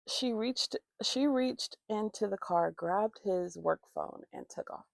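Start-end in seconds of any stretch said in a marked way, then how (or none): none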